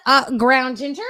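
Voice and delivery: usual speaking voice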